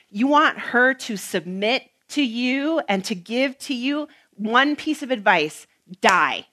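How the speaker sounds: full-force preaching. Acted. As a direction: drive the point home to the husbands.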